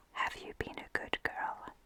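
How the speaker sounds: plain reading voice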